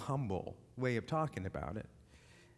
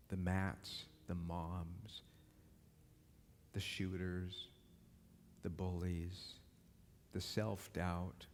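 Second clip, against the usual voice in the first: quiet invitation; 6 semitones lower, 8 dB quieter than usual. Acted. Preacher softly inviting the listeners to forgive, low and tender. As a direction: gently invite them to forgive.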